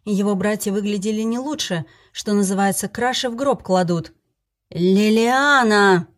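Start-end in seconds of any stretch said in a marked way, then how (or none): 4.7-6.1 loud, drawn-out shout upstairs. Acted. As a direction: yell the name at full power. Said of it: "во всю мощь закричал".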